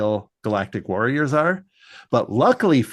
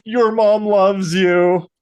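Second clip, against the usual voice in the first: teasing friends; 9 semitones higher, 5 dB louder, much slower than usual. Retold or imitated. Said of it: "his friends are like".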